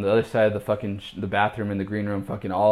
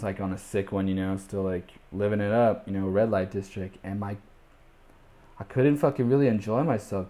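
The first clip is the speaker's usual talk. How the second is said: ordinary talk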